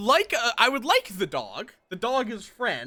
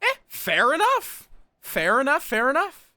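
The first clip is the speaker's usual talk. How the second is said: sing-song; 8 semitones higher, 4 dB louder than usual. Acted. Repeats the phrase in a playful sing-song.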